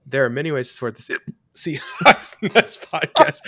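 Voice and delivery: giggling, can't hold the read; laughing through ad read. Real, not acted. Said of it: "I'm leaving this in 'cause it's kind of funny".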